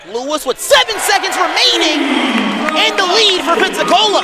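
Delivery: high, loud live basketball call; excited play-by-play. Real, not acted. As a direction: build excitement to the buzzer. Live call of buzzer-beating play.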